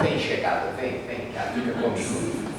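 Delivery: soft, low coaxing murmur; playfully hushed invitation; mock cuddling. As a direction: coax it close as if to a bedmate. Coaxing sadness into an embrace.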